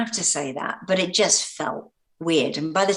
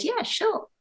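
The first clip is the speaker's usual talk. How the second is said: very high, light yes; retold breezy agreement; 3 dB quieter than usual. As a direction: toss off the consent way up high. Retells casually agreeing to press mention.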